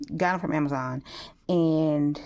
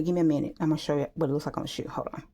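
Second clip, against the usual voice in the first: normal talking voice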